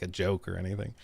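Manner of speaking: ordinary talk, nothing marked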